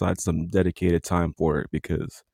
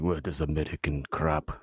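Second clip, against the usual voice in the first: low, soft mock-Russian Mountie; a Mountie who comes out sounding Russian; accidental Russian accent; 5 semitones lower, 9 dB quieter than usual. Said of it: "I don't know why they sound Russian".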